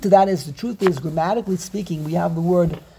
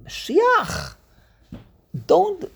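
higher, drawn-out plea to be left alone; high mock objection; protesting celebrant; 13 semitones higher, slower than usual. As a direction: call out in high-pitched protest. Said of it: "And you say to Mashiach".

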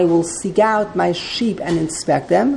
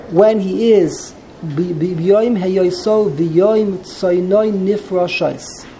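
ordinary delivery

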